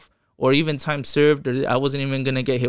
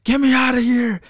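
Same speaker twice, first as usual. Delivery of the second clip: high, loud cry for rescue; imitated panic; 11 semitones higher, 4 dB louder than usual. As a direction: mimic a panicked friend yelling, pitched far up. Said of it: "because I heard him panicking".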